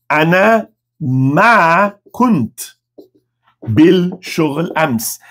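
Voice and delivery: "Ana... ma... kunt... bil... shoghl... ams", very slow, long gaps; word-by-word demo. Shown as a demonstration. Teacher introduces the negative answer, very slowly word by word.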